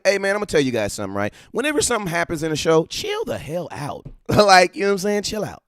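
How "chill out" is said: ordinary talk, nothing marked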